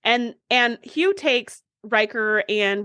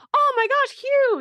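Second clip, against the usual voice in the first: high-pitched delighted gasp of recognition; excited surprise. Acted. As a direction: squeal it like a thrilled fan. Acted delighted surprise at seeing Hugh.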